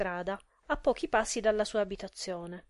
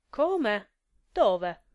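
flustered stammer; 5 dB louder than usual. A narrator's reading. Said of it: "balbettò".

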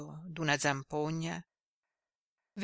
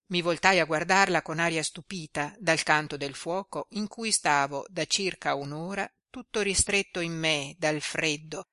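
ordinary delivery, nothing marked

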